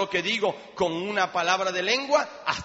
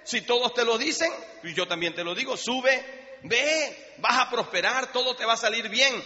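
mocking high sing-song, much higher pitch; sneering, mock-encouraging prophet; sarcastic; 6 semitones higher than usual. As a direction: egg the king on with open sarcasm. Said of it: "es una forma sarcástica de decir".

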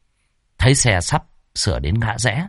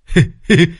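wide-mouthed laugh; 7 semitones higher, 4 dB louder than usual. Acted. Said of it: "ngoác mồm ra cười".

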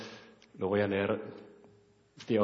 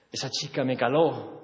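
normal talking voice

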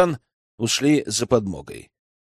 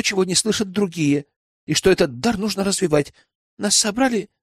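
put-on higher-pitched, earnest girl's voice; Веслава recounting; 5 semitones higher than usual. Young spy tells elder how prince found her, recounting. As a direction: tell it evenly in a girl's higher register.

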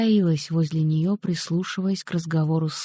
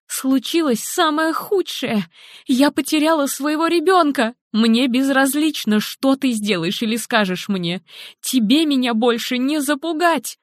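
high, defiant and grief-stricken; raging mother; 7 semitones higher than usual. Acted. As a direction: throw it at him, no longer afraid. Helen lashing out at husband.

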